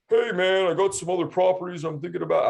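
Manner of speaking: breezy, airy impression of a buyer changing his mind; mocking the buyer.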